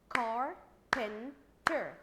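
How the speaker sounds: "Carpenter" in three loud, slow, separate beats; split into syllables. Shown as a demonstration. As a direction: chop "Carpenter" into three drawn-out, loud pieces. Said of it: "divide it into three".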